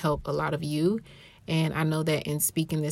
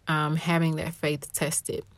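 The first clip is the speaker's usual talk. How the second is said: usual speaking voice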